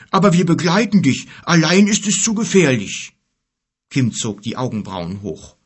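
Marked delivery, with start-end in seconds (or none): 0.1-3.1 high, loud giant voice; insistent giant. Acted. Giant offering the boy an escort, insistent. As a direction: insist loudly on coming along.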